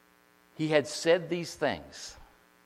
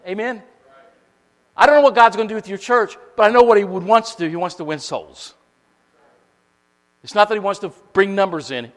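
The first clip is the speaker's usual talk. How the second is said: raised, earnest preaching; fervent preaching; 6 semitones higher, 9 dB louder than usual. Real, not acted. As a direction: testify with conviction. Preaching at full force.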